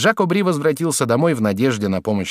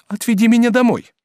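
high, loud woman's plea; pleading cry; 12 semitones higher, 6 dB louder than usual. A narrator's reading. Woman begs lover to take her home, pleading cry. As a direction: cry out a desperate request. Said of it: "вскричала Жервеза".

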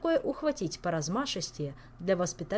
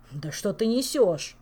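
furious, slow and loud; enraged; 2 semitones higher, 7 dB louder, slower than usual. Acted. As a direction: bark it out in rage. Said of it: "рассвирепел Элмар".

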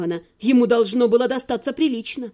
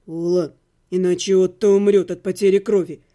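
low, steady and level; calm, even voice; 7 semitones lower than usual. A narrator's reading. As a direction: cut through her panic calmly. Necromancer calm, patient.

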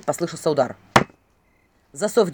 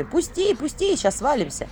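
mock cries; 8 semitones higher, slower than usual. Filin joking about their imagined flight, mock panicked cries. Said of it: "А я с криками".

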